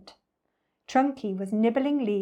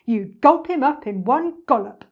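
loud, relishing storybook crocodile; animated, louder boastful croc voice; greedy enormous crocodile, taunting; 2 semitones higher, 9 dB louder than usual. Acted.

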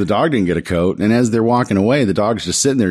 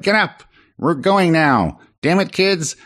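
a higher, hammed-up villain snapping at kids; mock Skeletor barking; 7 semitones higher than usual. Skeletor hurrying the freezing kids, mock gruff. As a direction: play the grumpy villain rushing the kids along.

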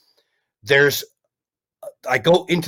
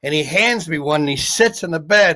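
ordinary talk

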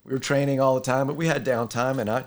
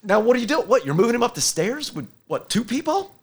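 higher, louder astonished quote; amazed firefighter; 7 semitones higher, 4 dB louder than usual. Retold or imitated. Firefighter watching the demo, higher louder mock astonishment. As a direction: sound stunned at what you just saw. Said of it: "they're like".

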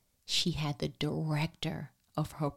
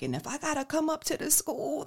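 much higher, warning mother voice; mom threat line; 10 semitones higher than usual. Retold or imitated. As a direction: threaten like every mother does. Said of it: "every mother uses that line".

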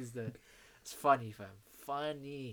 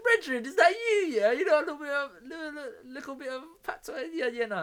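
high, loud Jamaican patois, laughing through it; drunk patois; 10 semitones higher, 10 dB louder than usual. Retold or imitated. Tipsy passenger chatting to Uber driver in Jamaican patois. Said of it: "I was speaking patois to my man".

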